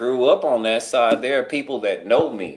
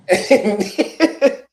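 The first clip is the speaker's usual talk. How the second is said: high, loud burst of laughter; 10 semitones higher, 5 dB louder than usual. Real, not acted. Laughing at his own punchline to the group, high and loud.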